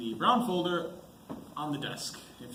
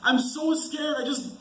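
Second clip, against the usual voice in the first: high, loud desperate pleas; frightened outburst near tears; panicked pleading; 11 semitones higher, 11 dB louder than usual. Acted. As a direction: beg in rising panic to go home. Terrified man breaks down pleading.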